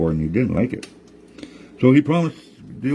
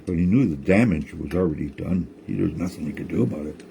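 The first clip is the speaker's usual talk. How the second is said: ordinary delivery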